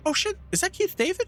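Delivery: very high fan squeak; high-pitched mock amazement; imitated excited recognition. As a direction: pipe up like a fan spotting their idol. Imitates friend spotting a favourite actor.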